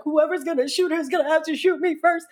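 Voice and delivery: high, urgent declaration; reenacted protective cry. Big sister shielding her from shots, high urgent reenactment. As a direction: say it high and frantic, as if running.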